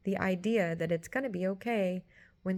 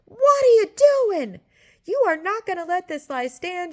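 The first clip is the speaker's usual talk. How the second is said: much higher, much louder shouted rebuke; conscience screaming; 12 semitones higher, 10 dB louder than usual. Retold or imitated. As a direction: scream like an inner voice catching a liar. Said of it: "it's screaming at me".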